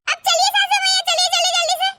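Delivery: high-pitched, insistent urging; bossy hurrying. Acted. Daughter-in-law orders mother-in-law to get to work, bossy and high.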